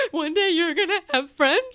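high, laughing, near tears. Retold or imitated. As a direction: gush at a baby through laughter. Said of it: "I just kept being like".

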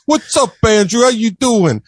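high-pitched imitation of a comic's greeting; Andrew Dice Clay. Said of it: "that's just the way he talked".

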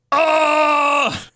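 long, high, hammed-up groan; mock orgasmic ghost moan. Imitating a ghost's post-death orgasm, exaggerated high moan. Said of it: "post-death orgasm".

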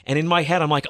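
plain reading voice

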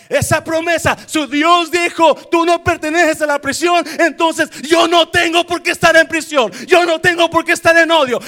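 loud, high, fired-up preaching; preaching at full force. Real, not acted. Pastor preaching freedom to congregation, loud and high. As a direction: shout it out and sing the promise to the church.

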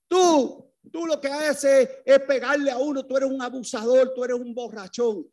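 put-on boy yelling at his drunk father, high and defiant; retold childish yell at father. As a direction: shout back at an abusive father like an angry boy. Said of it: "yo le gritaba desde el otro cuarto".

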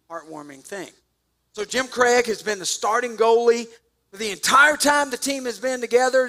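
ordinary talk

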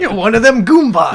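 loud, high mock sneer; mock tough guy. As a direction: sneer it like a cartoon bigot. Mocking voice for the slur.

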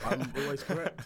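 loud, mock-arrogant boast. Host jokingly bragging to his co-host, a loud mock boast. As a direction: brag it like you mean it as a joke.

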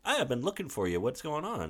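light, high impression of him; imitated boyfriend. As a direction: play the puzzled boyfriend, voice lifted. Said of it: "then he gets in like".

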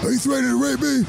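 high shouted wrestling promo; booming wrestler boasts. Pretend. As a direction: scream the trash talk to the crowd. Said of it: "impression of Stone Cold Steve Austin".

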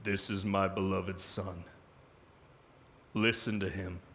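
a hint of a deep divine voice; voice of God. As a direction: speak as the divine voice from the cloud. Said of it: "a voice came out of the cloud".

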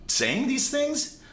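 high, incredulous whoa, slowing down on the question; shocked patient questioning the doctor; alarmed disbelief. Retold or imitated. Patient to careless vaccinator, high alarmed disbelief. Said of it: "And I'm like".

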